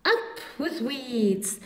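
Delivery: mild duckbill hoot; hooting dinosaurs. Acted. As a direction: call it out like a herd on the riverbank. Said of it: "And they hooted".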